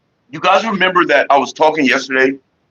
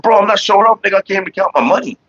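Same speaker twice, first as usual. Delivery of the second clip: defensive protest; 7 semitones higher than usual. Retold or imitated. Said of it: "I said".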